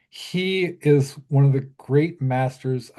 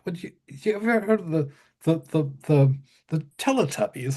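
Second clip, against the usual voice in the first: lightly voiced [name]; 3 semitones higher than usual. [name] asks about Teletubbies.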